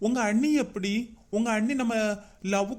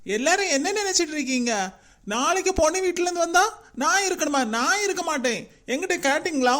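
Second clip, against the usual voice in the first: angry outburst; 5 semitones higher than usual. Acted. Son angrily confronting his father about the bride viewing. Son furious at arranged match.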